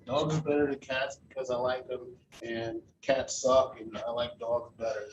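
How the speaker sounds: a hint of a flat first-timer reading his speech; monotone speaker. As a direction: mimic a bored nervous speaker droning one tone. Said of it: "He was monotone the whole time".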